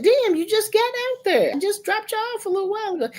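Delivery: high, fast exasperated protest; exasperated mom. Retold or imitated. Mother to son on the phone, high fast exasperated. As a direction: throw it back at him, fed up.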